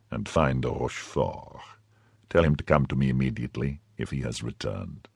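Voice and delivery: low, hushed order; subdued. A narrator's reading. Cardinal quietly sending the officer, subdued. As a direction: keep it down, a quiet command. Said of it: "said he in a subdued voice".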